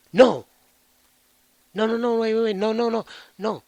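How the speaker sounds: hammed-up frantic no, no, no; imitated frantic pleading to spare him; panicked pleading. Begging not to kill brother.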